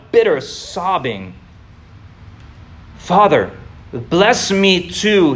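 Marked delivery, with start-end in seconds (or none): 3.0-5.3 slow, drawn-out plea with a mild tremble; begging. A narrator's reading. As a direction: stretch the words out as a son begging his father. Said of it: "he begged".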